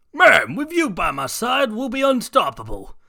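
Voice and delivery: high, loud excited villain muttering to himself; a gleeful crook sure of his new power; sludge villain voice. Acted. Villain gloating to himself after the robbery, high and loud.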